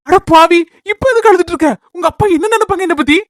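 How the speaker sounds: half-alarmed, half-teasing reproach; husband chiding his weeping wife; startled scolding. Acted. Husband scolds crying wife gently.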